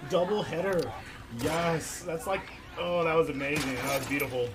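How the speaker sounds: loud, high, thrilled praise; excited cheering. Real, not acted. Gamer cheering a teammate's double kill, thrilled and loud. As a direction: cheer the play like a fan.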